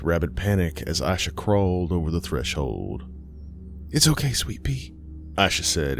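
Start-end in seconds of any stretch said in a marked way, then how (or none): 3.9-4.9 higher, worn-out soothing murmur to the dog; exhausted. A narrator's reading. Aisha calms her frantic dog, exhausted. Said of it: "exhaustion thick in her voice".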